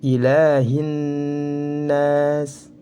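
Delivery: "Ilahin nas" with a long hummed double n; chanted "Ilahin nas", drawn out on n; idgham syamsiyah. Shown as a demonstration. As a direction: recite "Ilahin nas" slowly, n held, no l. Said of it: "idgham syamsiyah".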